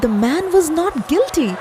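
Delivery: narrated with growing excitement; excited telling. A narrator's reading. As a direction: build the excitement of the miracle. Crowd cheers at miracle.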